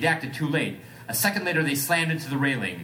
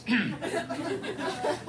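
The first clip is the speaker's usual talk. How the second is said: throat clear; 4 dB quieter than usual. Acted. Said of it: "Jello pudding pop voice".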